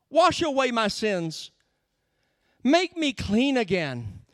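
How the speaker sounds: high, urgent plea to God; begging. Acted. Sinner confessing to God in psalm, begging. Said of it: "he's begging all the way through".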